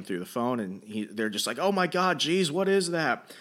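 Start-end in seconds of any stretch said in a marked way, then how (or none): 1.6-3.3 high-pitched alarmed exclamation; imitated startled panic; mock freak-out. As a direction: act out friends shocked by a sudden sight. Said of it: "he's freaking out".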